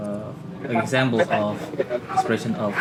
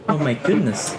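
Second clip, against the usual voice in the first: higher surprised exclamation; 5 semitones higher, 4 dB louder than usual. Shown as a demonstration. Student showing classmates how surprise sounds, surprised. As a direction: exclaim it in surprise, pitch lifted. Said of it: "for expression of surprise, you can say".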